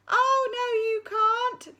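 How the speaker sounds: high sing-song panto reply; chiming chorus. Acted. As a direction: chime it like a whole hall. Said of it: "chimed the entire school".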